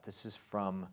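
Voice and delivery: usual speaking voice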